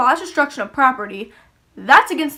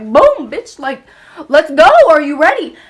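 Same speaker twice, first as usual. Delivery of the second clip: louder, cocky fight taunt; taunting yell; 3 semitones higher, 7 dB louder than usual. Retold or imitated. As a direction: call her out for round two, loudly. Taunting after punching her.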